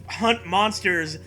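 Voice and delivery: ordinary talk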